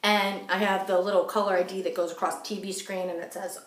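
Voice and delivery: ordinary talk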